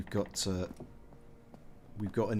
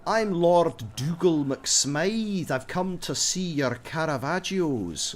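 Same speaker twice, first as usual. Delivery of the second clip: slow, high, hammed-up Scottish accent; bad Scottish accent; 6 semitones higher, 5 dB louder, slower than usual. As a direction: lay on a terrible fake Scottish accent, slowly. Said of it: "he did a really bad Scottish accent".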